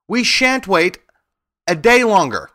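high, loud protest; indignant refusal. A narrator's reading. Scarecrow joins the angry protest.

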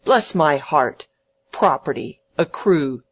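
loud, scornful exclamation, word by word; mocking echo. Mocking the letter's fine words.

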